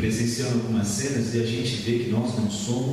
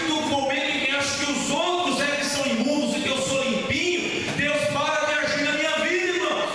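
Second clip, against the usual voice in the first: fervent high-pitched preaching, raised voice; preaching at full force; 13 semitones higher than usual. Acted. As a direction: preach it with fire to the congregation. Preaching with music behind.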